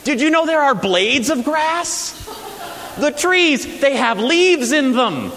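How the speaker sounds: high, loud, wide-eyed wonder at the world; a seven-year-old seeing leaves for the first time, thrilled; child's amazement. Retold or imitated. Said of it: "suddenly went".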